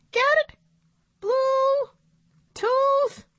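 very high, with pauses between words; squeaky monkey prodding for a laugh; monkey puppet. A narrator's reading. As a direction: spell out the joke in a squeaky puppet voice. Puppet monkey repeats punchline.

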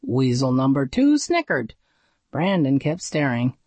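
ordinary delivery, nothing marked

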